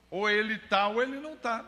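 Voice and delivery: desafio de pregador, agudo e forte; lançar um ultimato à congregação, subindo o tom; pregação enfática. Real, not acted. (pregação desafiadora, em tom agudo)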